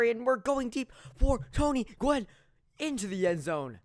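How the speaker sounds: loud, high play-by-play shout; sportscaster erupting, then easing off; excited touchdown call. Real, not acted. Calling a touchdown pass.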